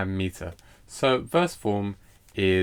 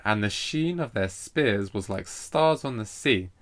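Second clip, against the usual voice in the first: slow reading leaning on "sheen", "spears", "stars", "sea"; drawn-out stresses on every third syllable; metrical verse reading; slower than usual. Shown as a demonstration. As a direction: read the line out so the galloping beat is heard. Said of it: "if we read it out, we might be able to hear it".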